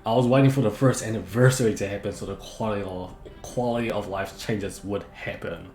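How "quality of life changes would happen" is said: ordinary delivery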